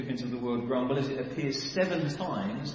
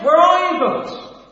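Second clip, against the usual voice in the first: high, loud pleading cry to God; desperate cry; 12 semitones higher, 14 dB louder, slower than usual. Acted. Enslaved people crying out to God, desperate and loud. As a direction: call out for help with everything.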